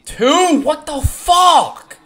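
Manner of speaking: high, loud, slow outburst; real fury then bitter sarcasm; furious shout. Real, not acted. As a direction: rage at the game. Furious at home run.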